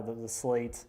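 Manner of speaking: ordinary delivery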